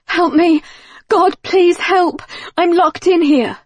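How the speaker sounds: high, loud panicked scream for help; terrified screaming. Acted. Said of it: "when he heard the screaming".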